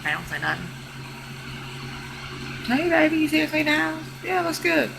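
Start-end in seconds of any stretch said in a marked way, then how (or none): 2.7-4.9 put-on high voice of a boyfriend; high mock boyfriend; mocking man. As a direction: imitate the man fishing for praise. Imitating man praising himself.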